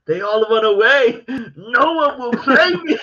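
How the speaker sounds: high, loud, drawn-out movie-quote cry; mock boastful wail. Acts out strutting down the pitch.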